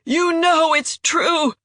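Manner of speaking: high, slow, strained plea; desperate plea. Acted. Wounded traitor begging Jandara to believe him, high and slow. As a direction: stretch it out, high and pained. Wounded man pleading, continuing cry.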